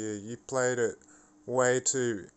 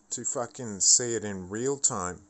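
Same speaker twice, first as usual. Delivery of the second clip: normal talking voice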